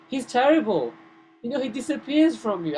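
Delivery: high, loud, indignant outburst; scornful criticism. Retold or imitated. Demigoddess warning Radharani about Krishna, loudly critical. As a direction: run him down with exasperation. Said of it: "this demigod is criticizing Krishna".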